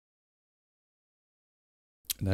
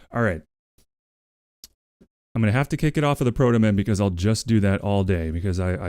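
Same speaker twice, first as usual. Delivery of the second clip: ordinary delivery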